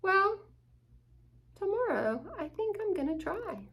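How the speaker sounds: lightly voiced determined pup; eager puppy. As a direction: sound like a brave small dog. Hugo resolves.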